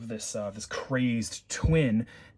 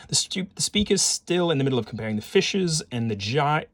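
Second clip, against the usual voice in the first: usual speaking voice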